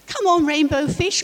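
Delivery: high, loud playful call. Acted. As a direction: call a friend over to play. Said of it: "called the other fish".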